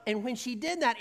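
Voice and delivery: normal talking voice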